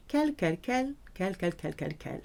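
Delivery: quel quel quel, fading from normal to very soft; repeated quel fading out. Shown as a demonstration. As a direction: repeat quel, letting each one fade. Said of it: "repeated drill fading".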